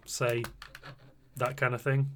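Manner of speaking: usual speaking voice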